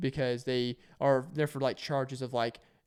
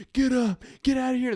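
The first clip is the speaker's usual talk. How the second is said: high, loud barked orders; sergeants shouting; 11 semitones higher, 6 dB louder than usual. Retold or imitated. Sergeants surrounding the kid and yelling orders, loud. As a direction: bark it at him like a drill sergeant. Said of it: "five sergeants around him going".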